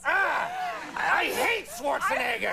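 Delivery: high, drawn-out joking shout; mock outburst. Guest host ribbing over a misused word, mock outburst. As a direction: cry it out slowly in mock protest.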